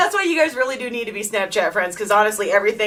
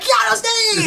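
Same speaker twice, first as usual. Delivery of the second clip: high-pitched, delighted hail; excited greeting; 13 semitones higher, slower than usual. Acted. Greeting Steve as a famous man, excited. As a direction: greet him as a star, pitched way up.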